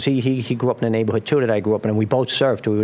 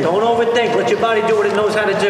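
louder, higher, fast encouragement; coaching shout; 8 semitones higher, 5 dB louder than usual. Real, not acted. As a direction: shout it out over the waves. Coach calling out in the surf.

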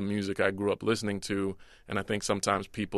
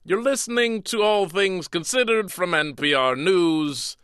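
Bill Cosby impression; 8 semitones higher, 3 dB louder than usual. Shown as a demonstration. Comedian reads NPR script to host as hammed-up Bill Cosby. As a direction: read the radio script as a mock Bill Cosby. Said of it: "this is my Cosby impression".

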